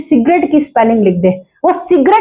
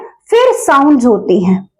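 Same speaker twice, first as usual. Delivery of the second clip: usual speaking voice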